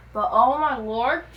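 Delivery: loud, slow oh my lord; overwhelmed exclaim. Real, not acted. Reacting to the epilogue for viewers, loud and slow. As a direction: gush about the ending.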